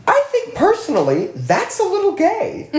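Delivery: high, laughing through the words; giggling as she says it. Real, not acted.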